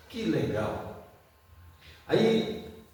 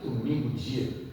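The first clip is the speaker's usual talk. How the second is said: tempting inner voice; 6 semitones lower, 3 dB quieter than usual. Retold or imitated. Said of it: "vem uma voz que diz assim".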